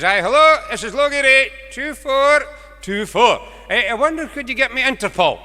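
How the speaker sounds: put-on a bumbling old farmer shouting down the line; old farmer on the phone. Old farmer shouting down the phone to the operator.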